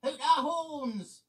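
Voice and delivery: very high, loud and slow, bursting with glee; loud excited laugh-line. A narrator's reading. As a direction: shout it out gleefully.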